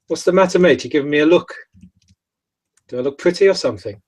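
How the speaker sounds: cheeky, mocking bloke; lightly voiced rude lad; cocky challenge. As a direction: square up with a smirk. Rude Carthian confronts stranger.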